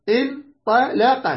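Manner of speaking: انطلق slowed right down, in- ta- la-qa; syllable by syllable. Shown as a demonstration. Teacher giving a five-letter example, slowly syllabified. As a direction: sound out انطلق in separate slow pieces.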